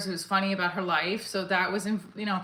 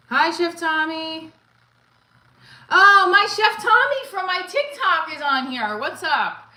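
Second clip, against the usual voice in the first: excited greeting; 8 semitones higher, 9 dB louder than usual. Real, not acted. Greeting a viewer on stream.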